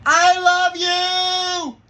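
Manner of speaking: huge drawn-out high holler; full-voiced dad shout across a schoolyard; yelling out the window. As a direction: holler it as loud as you can, stretching every word. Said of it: "I yelled out the window".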